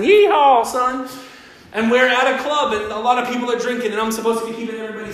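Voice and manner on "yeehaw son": cowboy holler. Retold or imitated. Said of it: "a whole bunch of cowboys".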